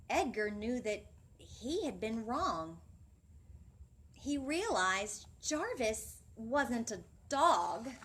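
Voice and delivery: put-on animated children's story voice; storytime reading. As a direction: read it to the class with big expression. Reads picture book aloud.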